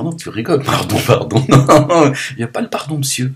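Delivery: mock the mother refusing forgiveness; imitating his mother. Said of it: "Ma mère, elle va dire".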